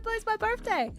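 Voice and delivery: put-on high whiny little-kid plea; pleading toddler. Toddler begging for the present. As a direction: plead like a small child. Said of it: "reached out her hand and said".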